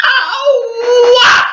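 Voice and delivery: sudden yelp. Real, not acted. Sudden outburst mid-rant.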